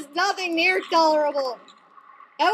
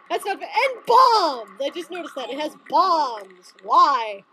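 usual speaking voice

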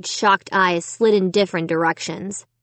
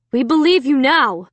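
higher-pitched, emphatic dad; emphatic declaration; 5 semitones higher, 4 dB louder than usual. A narrator's reading. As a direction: declare it firmly, voice raised. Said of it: "Dad declared".

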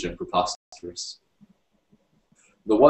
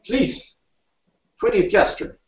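frightened; 3 dB louder, slower than usual. A narrator's reading. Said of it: "he was too frightened to be angry".

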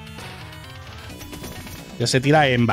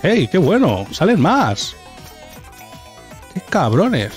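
excited; 5 semitones higher, slower than usual. Real, not acted. Aparecen más enemigos.

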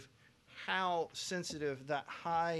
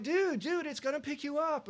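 much higher, louder "Dude, dude" plea; exasperated urging; 11 semitones higher, 4 dB louder than usual. Retold or imitated. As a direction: urge them excitedly to trust the mic. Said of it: "you'd be like".

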